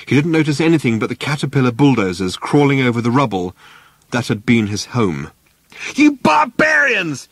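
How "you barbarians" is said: very high, slow outraged shriek; furious yell. Acted. Arthur yelling at the bulldozer crew, shrill outraged shout. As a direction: scream it at the wreckers of your home. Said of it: "he yelled".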